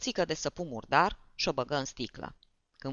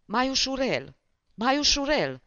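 high-pitched pleading call, louder on the repeat; Poceciuev calling out; 8 semitones higher, 4 dB louder than usual. A narrator's reading. Poceciuev pleads from the next room, high and alarmed. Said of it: "glasul lui Poceciuev".

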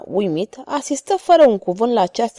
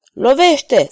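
loud, higher order to strike; shouted command; 5 semitones higher, 6 dB louder than usual. A narrator's reading. Ragastă shouts at Spada Capa to strike, loud. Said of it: "strigă Ragastă".

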